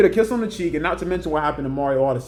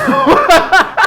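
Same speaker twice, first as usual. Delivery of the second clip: loud high laugh; 9 dB louder than usual. Real, not acted. Vlogger cracking up at Poppy leaving the cave.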